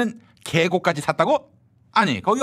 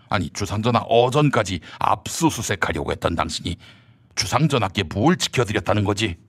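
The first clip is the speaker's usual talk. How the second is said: low stern minister; 12 semitones lower than usual. Acted. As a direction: press him like a cold interrogator. Low voiced rival character.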